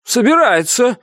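high-pitched, excited confirming cry; emphatic confirmation. A narrator's reading. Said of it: "подтвердил первый помощник".

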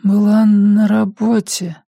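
high, slow recounting with pauses; an ailing mother effortfully piecing words together; frail, labored mother. Acted. Sick mother tells son what happened, frail and labored.

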